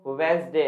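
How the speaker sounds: "वेडनेसडे" as wenz-day, silent D; two syllables in "वेडनेसडे", wenz-day; correct pronunciation. Shown as a demonstration. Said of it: "क्या होता है दोस्तों?". ("वेडनेसडे" को 'वेंज़डे' बोलें)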